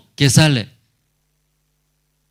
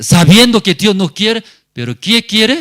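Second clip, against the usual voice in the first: fiery preaching; 10 semitones higher, 9 dB louder than usual. Acted. Preacher voicing the sinner's reasoning.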